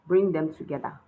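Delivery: usual speaking voice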